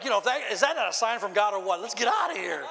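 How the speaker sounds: loud, high comic exasperation; exasperated outburst. Retold or imitated. Pastor retelling his reaction to being mocked, exasperated shouting. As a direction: shout it like you're ready to bolt.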